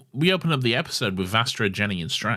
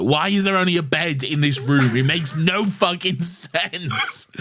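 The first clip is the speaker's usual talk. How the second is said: high, loud imitation of baffled outrage; freaking-out Doctor; 9 semitones higher, 4 dB louder, slower than usual. Fan retells the Doctor puzzled by a bedroom, hammed-up freak-out. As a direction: act out the Doctor's hammed-up bewilderment. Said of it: "the Doctor is freaking out".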